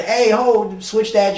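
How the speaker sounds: high, loud mock call to cut the beat; retold yell at the DJ to switch the track. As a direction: shout it up at the booth, half joking. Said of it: "You can't tell the".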